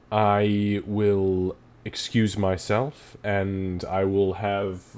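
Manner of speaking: genteel host, unhurried and polite; formal old druid host. Acted. Arc Druid welcomes guests, gently laying down rules.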